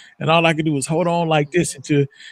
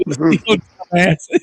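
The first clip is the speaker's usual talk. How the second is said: mock bystanders; 2 semitones higher, slower than usual.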